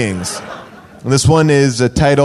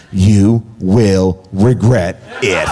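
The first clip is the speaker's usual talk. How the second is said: ominous warning; 11 semitones lower, much slower than usual. Retold or imitated. Ominous reading of review.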